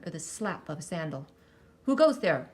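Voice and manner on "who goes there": loud, high roar of a startled guard; guard's roar. A narrator's reading. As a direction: bellow the challenge into the dark. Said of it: "roared a guard".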